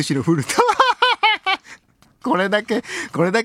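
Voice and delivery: drawn-out reading that collapses into laughter; breaking into laughter. Real, not acted. Host reading a listener's dream PC list, cracking up. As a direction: read it out and crack up on the last word.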